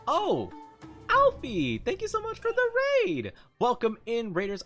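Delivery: high excited thanks; excited greeting. Real, not acted. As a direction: greet the raiders with real delight.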